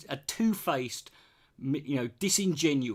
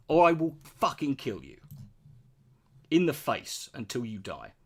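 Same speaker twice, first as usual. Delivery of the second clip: mock wrathful threat; 3 dB louder than usual. Mock threats to chatter Chris.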